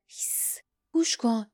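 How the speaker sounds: whispered shush, then a tense command; urgent hush. A narrator's reading. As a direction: shush them so they listen. Shushing a companion to listen.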